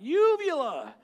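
"Uvula!" yelled high and loud at full force; screamed out. Retold or imitated. Preacher screams the word the Lord gave, "Uvula!" shouted. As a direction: blurt "Uvula!" as a sudden piercing scream. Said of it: "I just screamed out".

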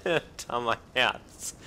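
shaky laughing after a scare; adrenaline shakes. Real, not acted. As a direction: heart racing, laugh it off. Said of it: "mega erschrocken". (zittrig vor Adrenalin)

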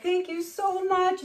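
high, slow, effusive thanks; tearful grateful owner. Retold or imitated. Owner thanks woman who caught the dog, effusive. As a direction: re-enact overwhelmed gratitude, drawn out. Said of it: "I'm like".

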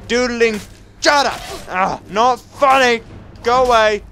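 high, loud yelps; flustered combat shouting. Real, not acted. Player fending off a monster, high flustered shouting. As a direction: yell at it to back off. Fights attacking restless dog.

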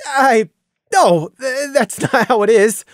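stammer, half laughing; flustered. Acted. Randy flustered denying.

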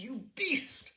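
rising furious cry; cried out in fury. Acted. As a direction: spit it at the drunk husband. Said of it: "I cried".

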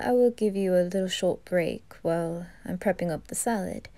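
ordinary talk, nothing marked

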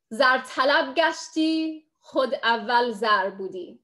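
slow, measured Farsi verse; poetry recitation. Acted. Speaker shares a favorite Rumi couplet in Farsi, reciting. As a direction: recite the couplet slowly, drawing out each line.

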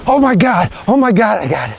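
thrilled high cry of disbelief; overjoyed, voice jumping up; excited. Real, not acted. Just shot the grouse.

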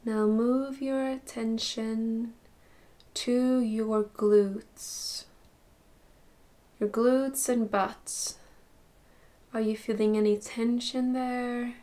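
soft, unhurried meditation voice with long pauses; guided meditation. Acted. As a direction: gently guide them through a body scan, slowly and softly. Leads body scan meditation.

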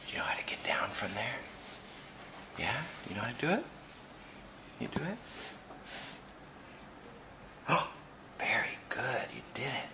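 whispering. Real, not acted. Parent whispering to toddler on the furniture, hushed whisper. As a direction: keep it secret, whisper so nobody hears. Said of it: "Are we whispering?".

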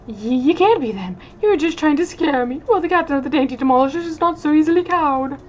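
rally the crew before charging; blustering dandy captain. Acted.

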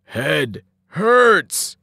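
a simple dazed giant moaning in pain; groaning giant. A narrator's reading. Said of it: "Tyson groaned".

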